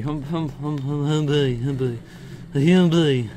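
playful, improvised character voice; silly put-on voice. Player riffing nonsense at his game, silly put-on voice. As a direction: goof around in a daft new voice. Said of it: "I've never done that voice before".

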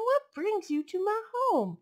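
high surprised question; exclaiming. Acted. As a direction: ask in glad surprise. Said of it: "Omu exclaimed".

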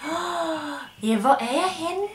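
slow, sung praise for the dog; sing-song pet praise. Acted.

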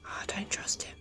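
hushed unvoiced aside; whisper. Real, not acted. Sneaking past a suspect, the player whispers to herself. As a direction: whisper it so nobody hears.

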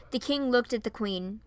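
ordinary delivery

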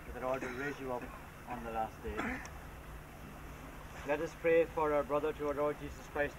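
usual speaking voice

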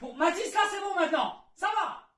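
put-on high, exasperated yell at kid; snap, patience run out; exasperated shout. Father loses patience.

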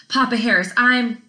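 mildly flustered apology; apologetic. A narrator's reading. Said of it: "she started immediately apologizing".

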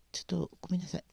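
思わず漏れた息だけの囁き; マイクから離れて息だけでそっと謝る; 小声の謝罪. Real, not acted. 配信中の小声の謝罪. (配信の合間にこっそり囁くように謝る)

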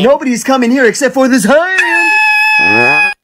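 slow, loud threat; threatening shout. Acted. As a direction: bark the threat out slowly. Dad threatening loudly.

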